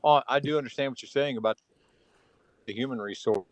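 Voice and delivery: normal talking voice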